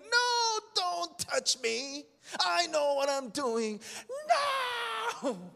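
put-on high whiny baby protest; baby crying out. Preacher voicing a stubborn baby before God, high and whiny. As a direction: whine it like a stubborn toddler. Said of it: "like a little baby crying out".

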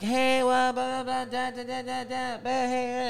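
high, sing-song mock gibberish of the chatty girls; mock chatter. Imitating the girls chattering in the cinema, high and sing-song. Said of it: "They're like".

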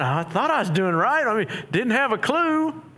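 high-pitched, clueless husband protest; baffled protest. Acted. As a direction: play the bewildered husband, climbing in pitch. Clueless husband caught out, acted.

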